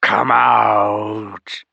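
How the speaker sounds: loud, wet, many-voiced hiss of a call; slithering monster summons; slithery dragon voice. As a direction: call out like a drooling serpent. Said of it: "a slithery voice called".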